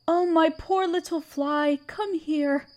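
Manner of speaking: ordinary delivery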